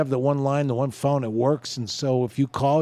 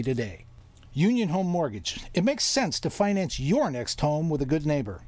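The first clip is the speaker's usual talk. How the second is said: ad read; 4 semitones higher than usual. Acted. Reads live sponsor ad.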